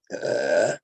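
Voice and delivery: low belch that escapes mid-sentence; deep belch. Real, not acted. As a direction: let out a real deep burp. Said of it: "uh, belch".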